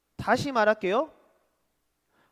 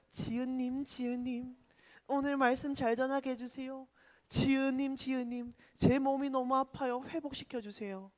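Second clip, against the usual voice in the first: pleading on one held note; mock prayer; 5 dB quieter than usual. Preacher pretending to pray to a friend as god, mock supplication. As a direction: pretend to pray earnestly, in an even chant. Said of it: "제가 지은이한테 이러는 거예요".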